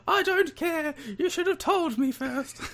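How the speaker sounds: very high-pitched Texas lady impression; mock Texas accent. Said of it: "All the accents coming out today".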